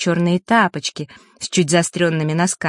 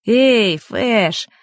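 raised-pitch call from indoors; lightly projected hail; 4 semitones higher than usual. A narrator's reading. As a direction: call out to a friend outside. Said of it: "неожиданно донеслось из домика".

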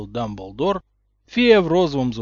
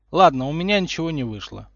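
usual speaking voice

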